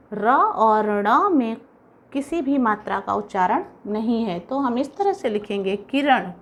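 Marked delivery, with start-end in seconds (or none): 0.1-1.6 slow, pointing out each letter; teacher slowly naming र and ण; slow explaining. Shown as a demonstration. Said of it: "देखिए". (शिक्षक की तरह धीरे-धीरे समझाते हुए)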